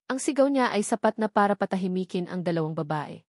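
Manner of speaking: ordinary talk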